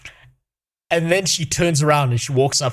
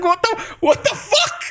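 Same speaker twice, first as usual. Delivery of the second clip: much higher, laughed out between cackles; laughing disbelief. Real, not acted. Streamer replays his shock at the request, laughing in disbelief. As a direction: squeak it out while laughing hard. Disbelief at tenant asking money.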